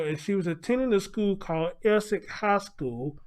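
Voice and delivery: ordinary delivery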